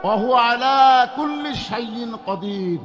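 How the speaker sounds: shouted, high call to the crowd; slow, loud Arabic recitation. Real, not acted. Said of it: "আরো জোরে বলেন".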